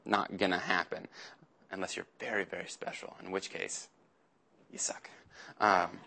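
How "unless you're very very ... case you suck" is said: whispered, almost silent aside; whispered joke. Real, not acted. To the youth group, a whispered teasing aside. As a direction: slip the punchline under your breath.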